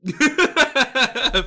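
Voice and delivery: loud, high burst of laughter; hearty laugh. Real, not acted. Laughing at own mistaken-Swedes story, hearty laugh.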